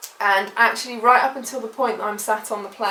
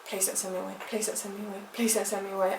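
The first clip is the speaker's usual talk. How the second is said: a soft inner plea, much softer; pleading under her breath; 9 dB quieter, faster than usual. Retold or imitated. At the check-in desk, begging silently not to be sent away, hushed. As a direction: murmur it like a prayer you can't say aloud.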